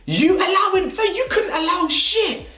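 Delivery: high incredulous squeak breaking into a laugh; mock disbelief. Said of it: "I said".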